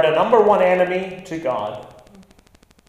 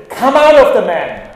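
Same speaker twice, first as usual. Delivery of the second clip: loud, higher command to cast out; commanding order; 6 semitones higher, 8 dB louder than usual. Retold or imitated. Jesus ordering the demon out of the man, loud command. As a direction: order the demon out with full authority.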